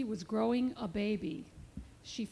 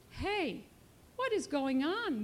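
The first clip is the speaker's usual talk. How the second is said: usual speaking voice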